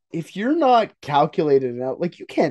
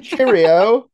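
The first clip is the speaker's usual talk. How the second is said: put-on British accent; 8 semitones higher, 7 dB louder than usual. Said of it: "how to do a fucking accent".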